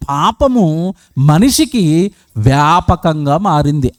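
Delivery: slow, weighty, every word stressed; slow emphatic. Acted. The preacher warns the congregation to listen carefully, slow and weighty. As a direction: lay this down slowly so they listen. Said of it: "ఇప్పుడు జాగ్రత్తగా వినండి".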